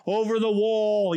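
mock yelling. Enemies yelling at builders, mild mock yell. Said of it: "these guys were yelling at".

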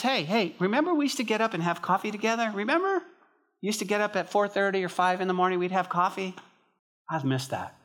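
high, quick, coaxing reminder; lightly voiced friend recalling shared times; the Lord reminiscing eagerly. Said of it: "And he says".

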